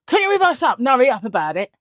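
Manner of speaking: quick, loud mocking of a rude young man; impatient bark at the driver; rude demand. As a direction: hurry him along, rudely.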